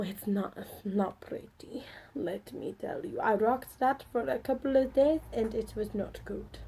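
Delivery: badly faked Indian accent; horrible Indian accent. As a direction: put on a hammy, bad Indian accent. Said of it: "That was my horrible Indian accent".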